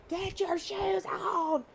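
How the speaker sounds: high, slow mock yell at kids; imitating a yell. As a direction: play-act the mom yelling at the kids, pitched high and drawn out. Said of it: "yelled at the top of your lungs".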